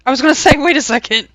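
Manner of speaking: loud, high, laughing protest. Real, not acted. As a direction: talk loudly through a laugh. Laughing while reacting to fire.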